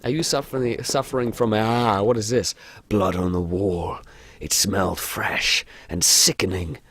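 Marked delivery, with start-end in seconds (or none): 2.9-6.8 American narrator, slightly lowered voice; measured American horror narration; American accent. Acted. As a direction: read the story in an American accent. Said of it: "Oh, he's American".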